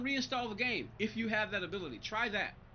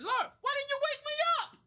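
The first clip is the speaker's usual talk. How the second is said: much higher, louder whiny plea; mock complaint to God; 7 dB louder than usual. Streamer retelling his morning prayer, high mock wail. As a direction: wail it up at heaven in mock outrage. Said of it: "I was mad at God this morning".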